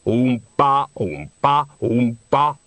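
orangutan grunting a tuba line; librarian's oompa. A narrator's reading. Orangutan librarian copying the tuba player's oompa.

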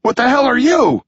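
high, loud stunned demand; shocked. Acted. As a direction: recoil and demand an answer. Nine faces transforming creature.

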